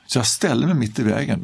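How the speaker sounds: ordinary delivery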